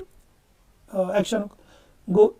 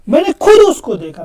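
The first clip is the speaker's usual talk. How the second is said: खुद punched much louder, the rest following; stress on खुद; 4 semitones higher, 11 dB louder than usual. Shown as a demonstration. Teacher proving a point to students with a loud, punched खुद. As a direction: convince them with a loud खुद. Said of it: "मैंने जोर दिया".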